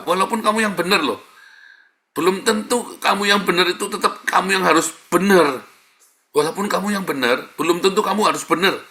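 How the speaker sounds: emphatic preaching. Real, not acted. Preacher hammering his point.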